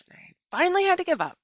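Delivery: high, fast burst of giving up; exasperated. A narrator's reading. Opal telling how she gave up, high and fast. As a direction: throw up your hands in a rush.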